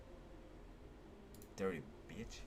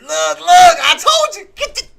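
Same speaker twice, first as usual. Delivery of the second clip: high, loud, drawn-out I-told-you-so; excited shout; 13 semitones higher, 13 dB louder, slower than usual. Real, not acted. Trader seeing his call come true, high shout. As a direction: shout it high, stretching the words.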